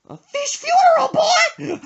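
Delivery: high, loud incredulous falsetto; mom indignant. Retold or imitated. Son retelling mom's outrage at his question, high loud falsetto.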